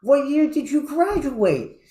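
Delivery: a joke's Irishman thrilled to find a classmate, excited. Acted. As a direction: stretch it out with giddy delight. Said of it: "he got all excited and said".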